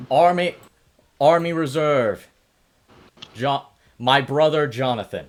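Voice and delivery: loud, ringing introduction; proud announcement. Acted. Forge loudly presenting the Ranger to the crowd. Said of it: "And he goes".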